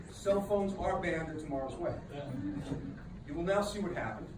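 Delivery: ordinary delivery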